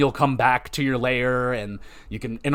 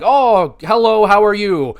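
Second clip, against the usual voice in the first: mock greeting, higher and louder; canned cheery greeting; 6 semitones higher, 8 dB louder, slower than usual. Game character greets the player with stock jargon, mock-cheery. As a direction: imitate a stock game-character hello, loudly singing out.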